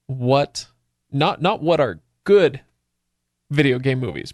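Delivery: usual speaking voice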